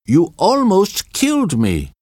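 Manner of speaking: high frantic screaming voice. A narrator's reading. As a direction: shriek it in outrage. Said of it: "Brunt screamed to von Lansen".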